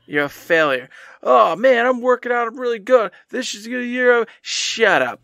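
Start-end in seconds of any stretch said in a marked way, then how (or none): 1.2-4.3 mock upbeat bragging. Mocking past self's cheer.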